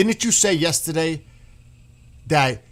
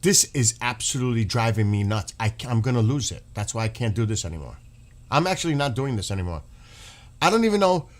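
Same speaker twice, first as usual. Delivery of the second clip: usual speaking voice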